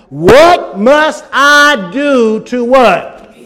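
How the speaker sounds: very slow, stretched question; drawn out. Acted. Preacher stretching the jailer's question, very slow. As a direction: hold every word for suspense. Dramatic pause in verse.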